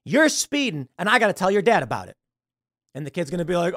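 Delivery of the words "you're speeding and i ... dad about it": high, louder scolding small-town cop, faster at the end; a friendly but firm local cop telling the kid off; stern cop. Retold or imitated.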